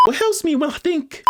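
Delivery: high-pitched put-on voice; high, artificial character read; switched-up character voice. Shown as a demonstration. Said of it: "so I'm gonna switch it up".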